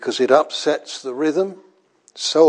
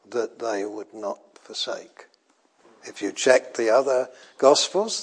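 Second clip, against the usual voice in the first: ordinary talk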